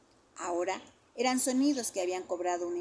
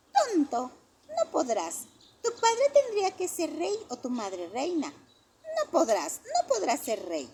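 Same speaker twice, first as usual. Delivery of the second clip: high, loud child mocking; sing-song schoolyard taunt; 9 semitones higher, 6 dB louder than usual. A narrator's reading. A kid mocks Johnny's dream of being king, loud taunting child voice.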